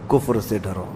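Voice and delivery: کفر سے ڈرو dropped to a near hush; hushed warning. Acted. Preacher warning his dear listeners again, hushed and low. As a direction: repeat کفر سے ڈرو softly, as an echo. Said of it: "میرے عزیزو".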